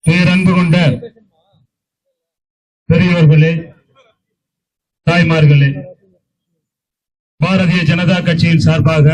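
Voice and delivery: thunderous political oratory. Acted. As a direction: declaim each name to the crowd. Formal rally address to dignitaries.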